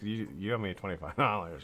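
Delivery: soft, laughing through a pretend demand; joke-demand payment, giggling; laughing mock demand. Suggesting Wendy bill Mike, laughing through the mock demand. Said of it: "You should call Mike and be like".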